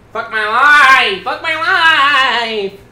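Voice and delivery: exasperated wail. Real, not acted. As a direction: stretch it out slowly, building to a wail. Despairing at the game, wailing.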